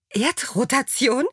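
high, slow questioning; a touch of baffled disbelief; incredulous echo. A narrator's reading. Alexa repeating Achim's odd word, high incredulous question.

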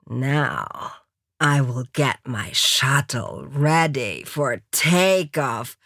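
low, slow English drawl; slow seductive build-up, pitched low; American lover. A narrator's reading. American readying his mistress for takeoff, low and slow.

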